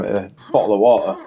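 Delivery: Yorkshire accent, bottle as bo'le with the t swallowed. Shown as a demonstration. Showing how it's said where he's from, Yorkshire accent. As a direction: eat the t's in bottle of water the Yorkshire way. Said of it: "where I'm from".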